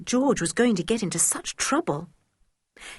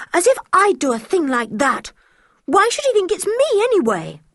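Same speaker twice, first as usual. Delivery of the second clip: indignant outburst; 11 semitones higher, 5 dB louder than usual. Acted. George furious at accusation.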